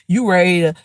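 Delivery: ordinary delivery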